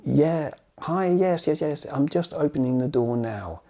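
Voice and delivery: hurried mock phone call. Pretend phone call during the lesson.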